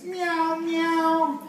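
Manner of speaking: high, drawn-out meows; cat meow. Acted. Teacher leading children in cat sounds, high meowing. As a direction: mew like a cat. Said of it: "A cat says".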